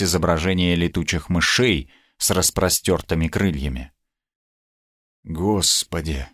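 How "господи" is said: soft, slow, low gasp of dread; shaken murmur. A narrator's reading. Knight facing the chained vampire, softly shaken. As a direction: breathe it out slowly, understated fear. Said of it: "проговорил я трясущимися губами".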